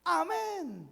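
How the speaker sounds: very high, drawn-out mock cheer; acted congregant's shout. Believer answering the preacher's promise, high acted shout. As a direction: whoop it like an eager believer. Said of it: "malalakas ang boses kapag sumisigaw".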